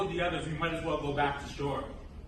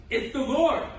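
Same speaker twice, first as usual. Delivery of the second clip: higher, louder burst of joy; excited recognition; 7 semitones higher, 5 dB louder than usual. Acted. John beams at spotting Jesus, excited exclamation. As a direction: exclaim it with sudden delight.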